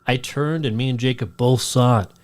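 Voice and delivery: usual speaking voice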